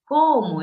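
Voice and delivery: teacher showing emphasis; emphatic cómo. Shown as a demonstration. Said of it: "intención de énfasis".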